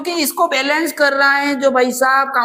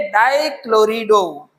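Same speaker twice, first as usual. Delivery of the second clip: डाइक्लोरिडो drawn out slowly, syllable by syllable; slow model term; 3 semitones lower, much slower than usual. Shown as a demonstration. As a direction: teach the term डाइक्लोरिडो, stretching it out. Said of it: "क्या बोलेंगे". (डाइक्लोरिडो को धीरे-धीरे खींचकर बोलें)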